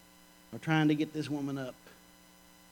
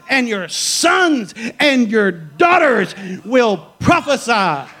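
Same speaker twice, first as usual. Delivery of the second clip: loud, high, driving sermon voice; preacher rousing the congregation on women prophesying; full-force preaching; 9 semitones higher, 11 dB louder than usual. Acted. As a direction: preach it to the back row.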